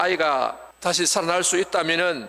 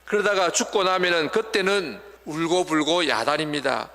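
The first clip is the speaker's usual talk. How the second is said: ordinary talk, nothing marked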